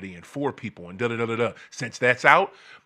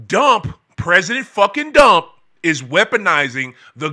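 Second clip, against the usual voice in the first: spits out the mocking name, loud and higher; bursting with anger at the president; angry outburst; 6 semitones higher, 8 dB louder than usual. Real, not acted. Ranting about the president, angrily loud.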